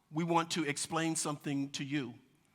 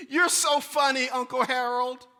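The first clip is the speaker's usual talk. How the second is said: put-on high, loud delighted child voice; little girl; 8 semitones higher, 9 dB louder than usual. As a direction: squeal it like a giggling toddler. Little daughter voiced in story.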